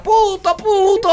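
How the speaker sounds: put-on very high toddler calling "Puto" for Pluto; little girl. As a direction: imitate a small daughter, way up high. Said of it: "La niña mía cuando chiquita le decía Pluto, puto". (niña chiquita llamando, muy aguda)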